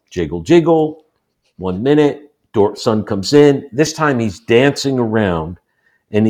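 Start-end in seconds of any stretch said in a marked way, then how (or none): none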